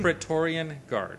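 Praetorian Guard said slowly, syllable by syllable; careful pronunciation. Shown as a demonstration. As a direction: spell out Praetorian slowly, pray-TOR-ee-an. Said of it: "Okay, say it in".

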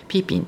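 ピーピン as Chinese "pi-ping", long first syllable; Chinese pronunciation. Shown as a demonstration. Host giving the whole Chinese word, ピーピン pronounced as Chinese. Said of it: "というふうに言うんですけれども".

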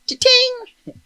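high-pitched "cha-ting" notification sound; message alert. Retold or imitated. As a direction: imitate a phone ping in a high voice. Imitates Facebook message alert.

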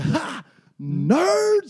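high, loud yelled "Nerds!"; mocking shout of "Ha! Nerds!"; screamed taunt. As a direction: jeer it at the top of your voice. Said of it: "So loud in the porta potty".

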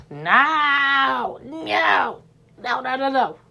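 high, wailing cry of no; anguished no. Real, not acted. Streamer wailing that his PC won't work, anguished. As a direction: wail over the broken game plan. PC not working, dismay.

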